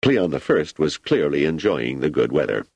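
ordinary delivery

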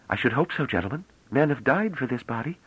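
high-pitched, poised stranger; self-assured young woman. Acted. Young woman presents herself to gentlemen, self-assured.

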